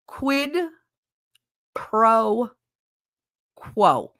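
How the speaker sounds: "Quid", "pro", "quo" said slowly with long pauses; word by word. Shown as a demonstration. Streamer forcing out quid pro quo one word at a time. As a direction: break "quid pro quo" into three slow separate words. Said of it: "Now I won't be able".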